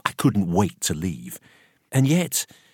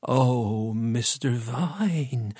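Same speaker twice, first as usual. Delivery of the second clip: slow, soft, drawn-out moan; 2 semitones higher, 4 dB quieter, much slower than usual. A narrator's reading. Missus Ruddock hearing of the death, moaning softly. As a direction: moan the name in mourning. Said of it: "she moaned".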